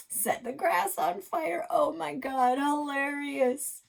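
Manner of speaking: mock her like a snickering brother; high slow teasing kid. Alex mocking Krista while laughing.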